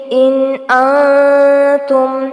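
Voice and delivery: slow انتم with the n hidden in the nose; انتم with a hidden nasal n; nasal ikhfa. Shown as a demonstration. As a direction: hum the n of انتم through the nose. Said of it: "نون کی آواز کو ناک میں چھپائیں گے".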